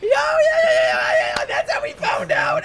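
very high shrieking yelps; real frantic screeching excitement; excited freak-out. Real, not acted. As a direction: squeal wildly at a big reveal. Freak-out at plot reveal.

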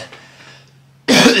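harsh choking cough from spice; spicy cough. Real, not acted. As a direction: cough hard on hot food. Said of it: "Oh, my throat burning".

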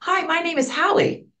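high, light imitation of a young woman's greeting; lightly voiced cheerful Halle introducing herself; imitating Halle. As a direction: pitch it up like a shy young star saying hi. Voicing young Halle Berry's greeting.

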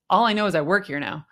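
ordinary delivery

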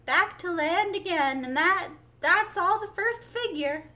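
high, loud storybook exclamation; excited calling. A narrator's reading. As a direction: keep calling out the dance excitedly. Excited dance calls continue.

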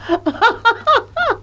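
high helpless laugh that runs on; mother laughing so hard she cries; laughing till tears. Acted. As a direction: laugh until you can't stop. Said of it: "Mutsch lachte, bis ihr die Tränen kamen".

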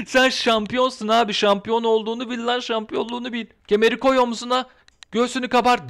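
high-pitched, insistent pep talk; exhorting. Acted. Urging the champion to carry the belt proudly, insistent exhortation. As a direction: urge her on, voice held high.

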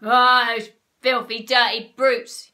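loud, heavy outraged yell; furious storybook bellow; angry yell. A narrator's reading. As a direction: curse them at full volume. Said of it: "yelled Mister Twit".

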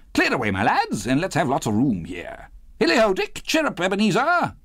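high, merry holler to his apprentices; rally the lads with hearty whoops; Fezziwig's jovial cry. A narrator's reading. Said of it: "cried old Fezziwig".

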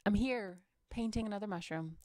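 ordinary delivery

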